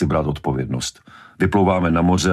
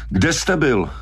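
higher, insistent interrogation; pressing demand; 10 semitones higher, slower than usual. A narrator's reading. Brody squeezing harder for an answer, demanding. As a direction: bear down hard on the question. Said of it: "Brody přitlačil".

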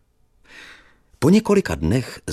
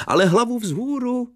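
put-on cheerful old teacher. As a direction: chatter fondly like a relieved old teacher.

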